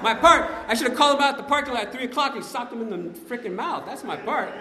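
high, heated comic protest; acted indignant retort. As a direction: insist hotly, playing up the outrage. Acts out indignant answer to partner.

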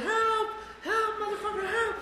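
high, laughing fake cry of help; mock plea for help. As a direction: whine for help to the spouse. Calling spouse in panic.

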